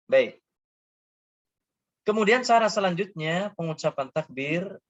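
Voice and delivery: ordinary talk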